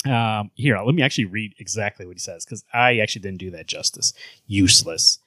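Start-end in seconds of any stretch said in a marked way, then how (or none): none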